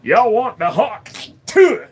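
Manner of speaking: slow, high, hammed-up Southern drawl; exaggerated Southern drawl; Southern accent. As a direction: drawl it out like a good ol' boy. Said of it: "different Southern accents".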